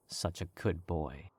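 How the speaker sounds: usual speaking voice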